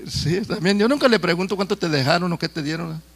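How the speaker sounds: normal talking voice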